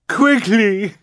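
high, loud final call. A narrator's reading. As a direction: call his name one last time. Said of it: "Violet called one more time".

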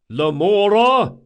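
high, drawn-out bellow through a door; rough caller demanding attention at full volume; loud male shout. A narrator's reading. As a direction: bellow the name to summon him. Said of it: "came a loud male voice".

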